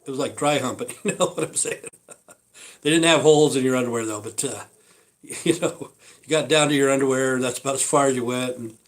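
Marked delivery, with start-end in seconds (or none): 1.0-1.9 giggling through the question; breathy laugh as he asks. Real, not acted. Laughing as he tells viewers about the joke. 5.4-5.9 laughing. Real, not acted. Still laughing.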